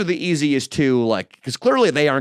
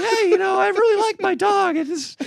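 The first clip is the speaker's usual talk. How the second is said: imitating Nico. Nico defending his dog, in a high mocking voice. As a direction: squeak out Nico's timid defence of his dog. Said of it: "He's like".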